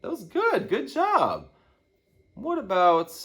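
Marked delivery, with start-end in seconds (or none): none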